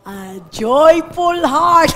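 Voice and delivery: slow loud words bursting into laughter; joyful laugh. Real, not acted. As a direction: laugh out the joy. (masayang tawa)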